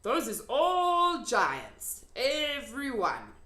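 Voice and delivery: the giant showing Sophie the land; solemn giant. A narrator's reading. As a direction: announce it slowly as the giant. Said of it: "the B F G said".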